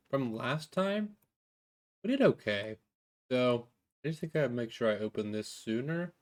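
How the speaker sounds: ordinary talk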